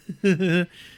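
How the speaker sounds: put-on high, loud drunken cackle; drunken laugh. Swaying drunk on the bus, imitated helpless laugh. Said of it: "just laughing, just swaying".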